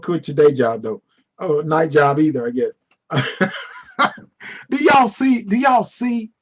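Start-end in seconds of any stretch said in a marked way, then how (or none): none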